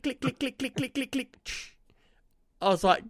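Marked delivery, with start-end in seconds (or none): 0.0-1.4 high, soft rapid clicks; imitated anchor clicking. Player mimicking the anchor chain after the crash, imitated clicking. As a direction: tick out the clicks in a small high voice. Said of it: "you hear this click".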